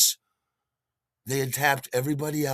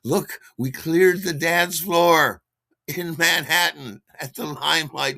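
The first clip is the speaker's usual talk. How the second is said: louder, slower, higher crowing to himself; the brain cheering in triumph; giddy, drawn-out brag; joyful inner voice; 5 semitones higher, 8 dB louder than usual. Retold or imitated. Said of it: "my brain is telling me".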